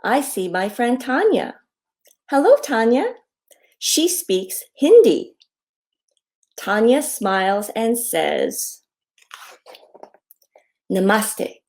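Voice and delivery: slow down and sing out each greeting; reading to children. A narrator's reading. Author reads her hello book to children, friendly read-aloud.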